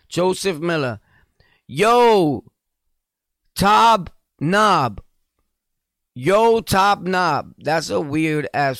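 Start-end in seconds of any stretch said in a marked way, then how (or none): 1.7-7.5 calling out. Real, not acted. Calling out commenter name.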